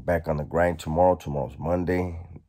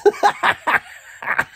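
airy, wheezing laughter way up high; breathless laugh; 5 dB louder than usual. Real, not acted. Remembering the cellmate checking his shorts, wheezing laugh. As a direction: laugh with no voice left.

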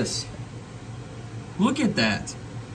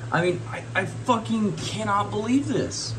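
exasperated disbelief; 8 semitones higher, 6 dB quieter than usual. Real, not acted. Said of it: "I'm just pretty damn heated".

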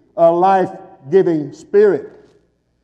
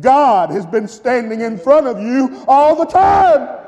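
preaching shout; 6 semitones higher than usual. Acted. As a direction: proclaim it to Thomas. Preacher shouts Jesus' words.

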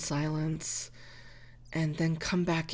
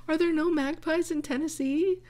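pitched high in disbelief; higher, baffled wonder; surprised question; 10 semitones higher, 3 dB louder than usual. Real, not acted. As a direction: ask it as if the idea is absurd. Doubting whether magpies exist there.

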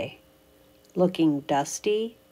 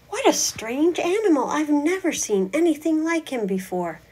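much higher and louder in wonder; high amazed character; astonished high voice; 9 semitones higher, 5 dB louder, faster than usual. Acted. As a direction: gasp at the odd creature.

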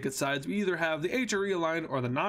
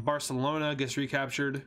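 normal talking voice

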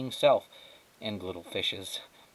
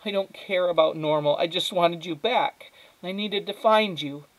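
high, loud, emotional plea; tearful; 9 semitones higher, 5 dB louder than usual. A narrator's reading. Mother wants her daughter back, tearful. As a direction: plead as the tears start. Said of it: "she started to cry again".

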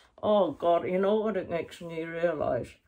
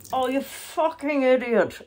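annoyed shout; 7 semitones higher, 7 dB louder than usual. Real, not acted. Soaked by water, yelling.

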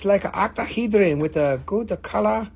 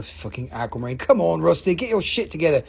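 usual speaking voice

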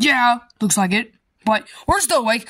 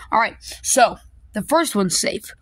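ordinary talk

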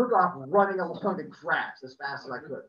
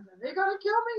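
put-on much higher, scared child voice; worried squeak; 13 semitones higher, faster than usual. Scared child to neighbour, high worried question. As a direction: ask it like a frightened kid.

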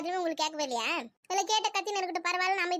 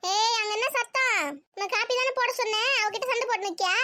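high, raised scolding; mother breaking up a squabble; calling out sternly; 4 semitones higher than usual. Acted. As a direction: shout a reprimand to the next room. Mother calls out over quarrel.

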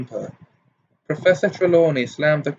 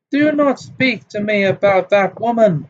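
high, loud outburst at the name; furious cry; 7 semitones higher, 3 dB louder than usual. A narrator's reading. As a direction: snap it out, offended. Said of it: "cried Professor Trelawney".

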